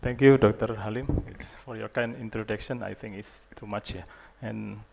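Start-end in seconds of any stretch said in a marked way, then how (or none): none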